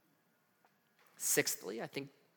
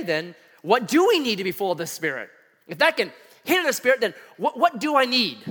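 normal talking voice

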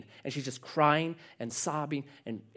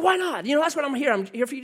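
agitated protest; 10 semitones higher, faster than usual. Retold or imitated. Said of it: "getting agitated".

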